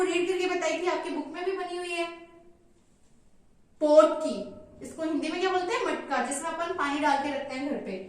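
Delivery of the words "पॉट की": slow naming. Shown as a demonstration. Teacher naming the picture for pupils, slow. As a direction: say पॉट की slowly and clearly. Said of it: "ये किसकी picture है".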